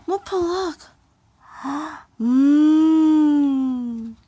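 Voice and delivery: high excited gasping; high, breathless delight; excited gasps. Acted. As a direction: gasp with delight at the pet. Excited over food.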